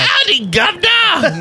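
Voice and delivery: high, drawn-out, hammed-up English accent; terrible English accent. Host mocking own failed attempt, bad English accent. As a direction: call it out in a mock English accent. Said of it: "That was the worst English accent".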